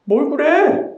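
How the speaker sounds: high, brazen shrug of a line; a shameless high retort. Acted. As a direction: toss it off shamelessly. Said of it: "하면서 뻔뻔하게 말합니다".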